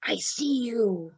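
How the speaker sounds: slow, soft imitation of someone gasping; breathless spirit voice, put on; gasping for breath. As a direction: drag the words out as if short of air. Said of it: "gasping for breath".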